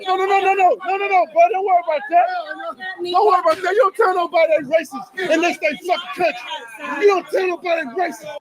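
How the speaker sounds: high, heated, insistent yelling; angry shouting. Real, not acted. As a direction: argue at the top of your range, furious. Heated argument over racist remark.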